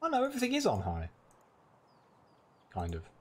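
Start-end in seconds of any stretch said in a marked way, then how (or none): 0.0-1.1 surprised. Real, not acted. Surprised at game settings.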